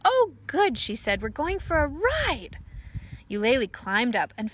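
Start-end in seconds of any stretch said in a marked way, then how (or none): 0.0-0.8 put-on high, bright excited child voice; a tiny creature delighted to go; high excited squeak; Eulalie's little voice. A narrator's reading. 1.2-2.6 a touch higher, eager little voice; small excited creature voice; lightly voiced tiny child. A narrator's reading. Eulalie speaking in story.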